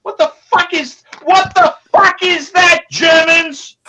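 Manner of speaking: angry shout. Acted. Ranting at the catalog.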